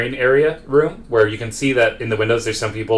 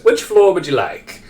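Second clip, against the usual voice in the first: high, slow butler voice; mid-century butler; 8 semitones higher, 4 dB louder, slower than usual. Acted. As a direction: draw out a formal butler's question, pitched up. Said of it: "And the butler says".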